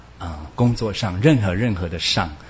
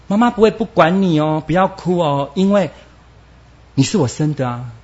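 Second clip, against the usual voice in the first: soothing mother; 6 semitones higher than usual. Acted. Mother comforting her child.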